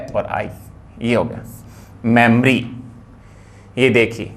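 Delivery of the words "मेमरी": मेमोरी as two syllables, 'mem-ree'; correct pronunciation. Shown as a demonstration. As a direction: drop the 'o' from मेमोरी. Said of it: "लेकिन होता सही क्या है?". (मेमोरी को 'मेम-री' बोलें)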